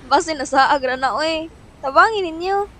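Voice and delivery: worried sibling calling out for help; loud pleading. Acted. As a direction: beg them to help, urgently. Pleading friends to help find sister.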